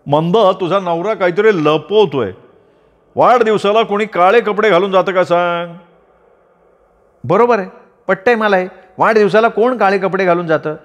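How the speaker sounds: a busybody uncle wagging his finger; meddling old uncle. Acted.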